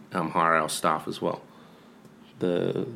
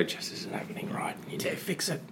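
whisper; 10 semitones higher, 11 dB quieter than usual. Retold or imitated. Retold order to staff.

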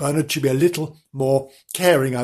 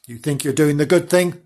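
ordinary delivery, nothing marked

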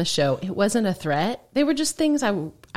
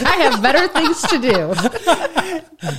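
mock Darren; 9 semitones higher, 5 dB louder, much slower than usual. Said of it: "Darren's like".

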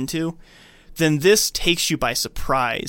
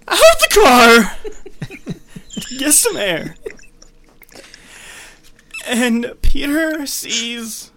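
hammed-up sad narration; 8 semitones higher, 5 dB louder than usual. Shown as a demonstration. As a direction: overplay tragedy for laughs. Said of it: "Louder and sadder".

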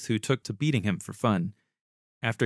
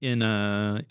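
normal talking voice

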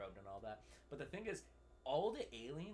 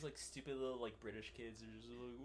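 ordinary talk

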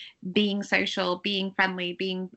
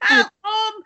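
laughing; 12 semitones higher, 9 dB louder than usual. Real, not acted. Laughing banter.

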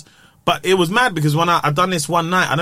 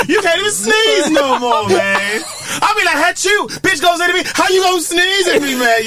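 higher, hyped-up stage voice; American comic voice; 14 semitones higher, 3 dB louder than usual. Pretend. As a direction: lightly do a hype US comic. American hype voice bit.

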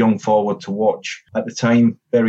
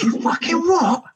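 much higher, slow, incredulous roar as the gaffer; furious manager; slower than usual. Retold or imitated. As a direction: mimic the raging manager squaring up. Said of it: "He goes".

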